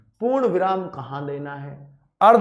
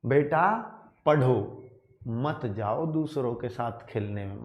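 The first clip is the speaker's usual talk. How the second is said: पढ़ो drawn out, then a pause before a softer मत जाओ; pause after पढ़ो; 5 semitones lower, 5 dB quieter than usual. Shown as a demonstration. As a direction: slowly, break the phrase right after पढ़ो. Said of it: "एक बाप ने अपने बेटे से बोला".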